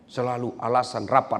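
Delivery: ordinary delivery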